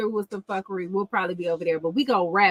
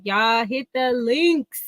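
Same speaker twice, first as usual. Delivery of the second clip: usual speaking voice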